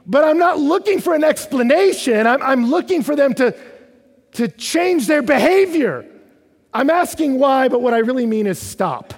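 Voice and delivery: high, shouted preaching with pleading force; impassioned preaching. Real, not acted. Preacher voicing the psalmist's plea to God, impassioned shout. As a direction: cry out demands and pleas at full voice.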